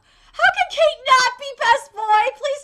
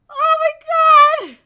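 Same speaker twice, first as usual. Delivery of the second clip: emotional outburst; 5 semitones higher, 8 dB louder than usual. Real, not acted. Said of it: "I'm gonna cry".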